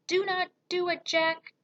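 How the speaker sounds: very high panicky plea; screech. A narrator's reading. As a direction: screech it without much volume. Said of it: "he screeched".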